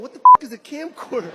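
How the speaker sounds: put-on loud, fast baffled kid; confused teen. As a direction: blurt it out like a puzzled teen. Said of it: "kids in here ... going".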